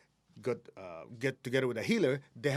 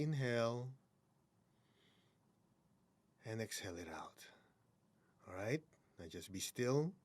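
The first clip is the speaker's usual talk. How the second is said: guided meditation; 3 dB quieter than usual. Acted. Guiding the podcast audience through a hand exercise, softly. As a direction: ease them into calm breaths.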